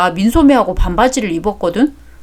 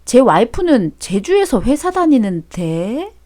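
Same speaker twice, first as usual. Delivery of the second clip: usual speaking voice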